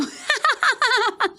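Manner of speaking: high delighted laugh breaking out; burst of laughter. Real, not acted. Guest laughs, delighted at the host's guess, high laughing burst. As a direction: laugh out loud at being read so easily.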